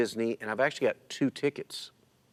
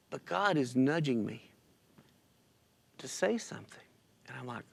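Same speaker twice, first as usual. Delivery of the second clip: ordinary delivery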